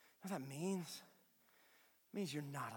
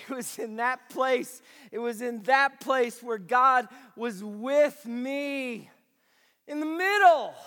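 fervent preacher building to shouts; preaching; 8 semitones higher, 12 dB louder, slower than usual. Acted. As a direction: preach it with full conviction. Preaching to congregation.

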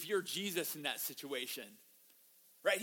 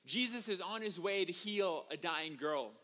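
normal talking voice

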